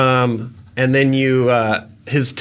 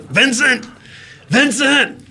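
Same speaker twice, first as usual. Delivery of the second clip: high calls down the hall; shouting a name; 12 semitones higher than usual. Acted. Rat lord shouting down the hall for his servant. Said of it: "he shouts for Vincent".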